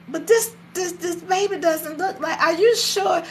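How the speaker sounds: high-pitched, hesitant questioning; imitating a husband stammering his doubts; doubting father. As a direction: press the doubt in a high, halting voice.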